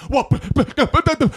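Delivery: high stammering with a laugh; stammering mock politician. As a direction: sputter helplessly, laughing. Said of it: "they're just like".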